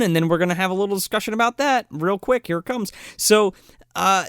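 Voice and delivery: usual speaking voice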